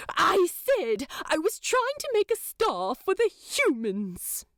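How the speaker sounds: a prim angel, caught out and flustered; Aziraphale voice. A narrator's reading. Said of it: "Aziraphale takes a deep breath".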